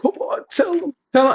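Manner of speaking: put-on high pleading girl voice; mock girl begging. Girl begging friend, mock high pleading. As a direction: beg in a high girly voice.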